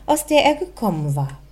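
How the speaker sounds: plain reading voice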